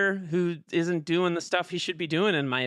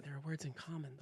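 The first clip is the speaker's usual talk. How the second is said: softer again, hushed echo; soft repeat; 3 semitones lower, 19 dB quieter, faster than usual. Shown as a demonstration. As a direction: echo the hint even more hushed. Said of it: "there are some words in common".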